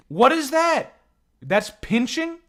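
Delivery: high, loud disbelieving squawk; real shock at a strange noise; baffled outburst. Real, not acted. Streamer reacting to a weird sound in the game, baffled and loud.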